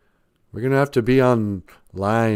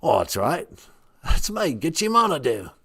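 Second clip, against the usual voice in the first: higher, far-off regal English lady voice; Queen of England voice; 5 semitones higher than usual. Acted. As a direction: speak as a grand queenly deity. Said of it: "you kinda sound like the Queen of England".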